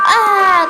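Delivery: short, high, loud play scream; startled scream. Pretend. Player sees many zombies, startled scream. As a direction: cry out at a sudden scare.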